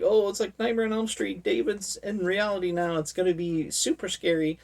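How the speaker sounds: mock excitement. Viewer hyping the villain's arrival, mock excited. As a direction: play up the horror hype. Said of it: "so it's like".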